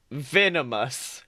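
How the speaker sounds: ordinary talk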